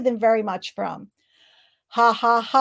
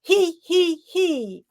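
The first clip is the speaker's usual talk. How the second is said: tittering giggle up high; 6 semitones higher, much slower than usual. Shown as a demonstration. Coach leading the group in a high he he he. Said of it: "Breathe in".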